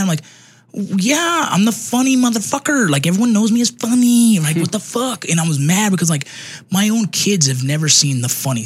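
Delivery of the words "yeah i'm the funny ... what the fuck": high-pitched, worked-up mock outrage; retold indignant anger. Dad replaying his inner rant at his daughter, indignant and mad. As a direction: protest it much higher, offended and exasperated. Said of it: "in my head, I'm so mad".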